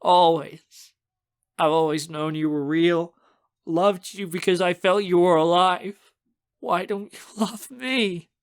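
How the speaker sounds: high, tearful plea that slows at the end; breaking into sobs. A narrator's reading. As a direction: plead through rising tears. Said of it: "I dissolve into a fit of sobs".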